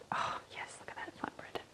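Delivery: hushed whisper to herself. Real, not acted. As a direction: breathe it out in a whisper. Whispering to self over food.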